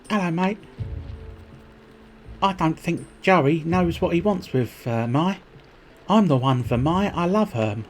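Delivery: high, hammed-up Cockney-Australian voice; [name]'s Cockney-Australian accent. Biker declaring himself the one for Mai, mock Cockney-Australian accent. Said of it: "I think he's supposed to be Australian".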